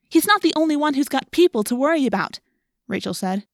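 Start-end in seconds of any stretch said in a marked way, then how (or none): 0.1-2.4 loud, high indignant outburst; heated objection. A narrator's reading. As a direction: cut in on a friend angrily. Rachel cuts in angrily.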